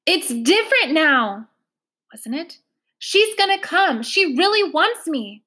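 put-on desperate girl defending her mother; insistent girl. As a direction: insist she's coming, almost pleading.

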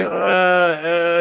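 drawn-out, noncommittal filler sounds; mock hesitation of a cornered salesman; imitated evasive hemming. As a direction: stall as if dodging the question. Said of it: "beating around the bush".

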